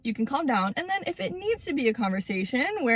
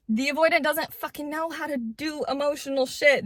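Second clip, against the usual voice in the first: usual speaking voice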